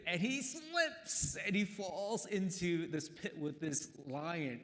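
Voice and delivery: storyteller pitching up at the fall; dramatic telling. A narrator's reading. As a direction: voice jumps high and slows on the slip.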